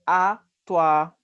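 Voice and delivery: ordinary talk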